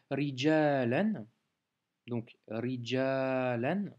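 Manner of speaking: rijalan read slowly, ending in lan. Shown as a demonstration. Teacher modelling the lan ending, slowly. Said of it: "c'est un lan".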